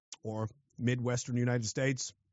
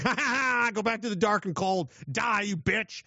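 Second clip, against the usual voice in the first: higher-pitched gloating cackle into a jeer; mock villain taunt; 6 semitones higher than usual. Winter sun teasing freezing Canadians, in a gloating villain taunt.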